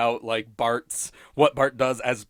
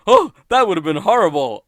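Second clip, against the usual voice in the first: loud, higher-pitched mock horror; Homer; 6 semitones higher, 9 dB louder than usual. Homer realizing he nearly strangled baby, mock horrified. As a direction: imitate a sudden horrified realization, loudly. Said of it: "And Homer goes".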